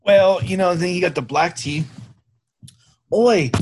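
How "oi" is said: loud, high mock British "Oi!"; a failed stab at a British accent, shouted "Oi!"; hammed-up British "Oi!", higher and louder; bad British accent. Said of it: "Can't do a, a British accent".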